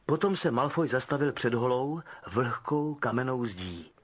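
usual speaking voice

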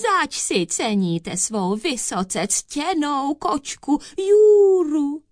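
high, slow fawning question; sugary falsetto, stretched out; tiny thin voice. A narrator's reading. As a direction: coo it, rising loud on the cat's name. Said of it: "tenunkým hláskem".

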